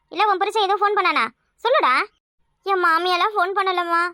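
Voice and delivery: ordinary talk, nothing marked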